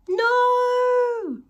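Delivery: high, loud squeal of shock; shocked cry. Acted. Reader cries out at the purple gerbil, high and loud. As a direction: squeal it out in mock horror.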